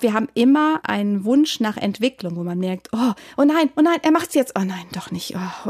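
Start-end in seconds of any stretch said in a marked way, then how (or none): 2.9-4.5 higher-pitched mock dread, oh no; gripped viewer. Viewer following a film's twists, mock alarm. 4.6-5.7 soft, hushed let-down; deflating suspense. Acted. Viewer seeing the twist not happen, hushed let-down. Viewer's tension drops away.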